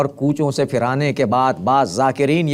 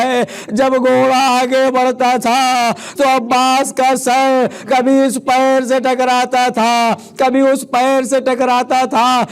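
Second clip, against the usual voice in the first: high, sustained lament oratory, building in intensity; impassioned majlis preaching; 11 semitones higher, 3 dB louder than usual. Real, not acted. Reciter tells mourners of Karbala's severed heads, impassioned high-pitched preaching. Preaches the tragedy at full force.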